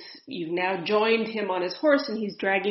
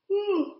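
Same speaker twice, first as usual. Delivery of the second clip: a sudden sharp high whew of breath; energy release exhale; 12 semitones higher than usual. Real, not acted. Medium starting a session, releasing energy with a whew. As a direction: let the energy out in one quick blown whew. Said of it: "That's actually just a release of energy".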